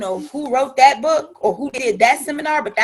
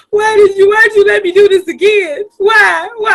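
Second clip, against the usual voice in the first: higher, louder pleading why; retold delivery room cry; 8 semitones higher, 8 dB louder than usual. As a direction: wail it like in labor. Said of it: "I remember having my second child".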